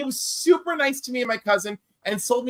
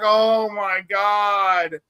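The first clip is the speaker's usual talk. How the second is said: exasperated oh my god; much slower than usual. Retold or imitated. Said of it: "and I'm like".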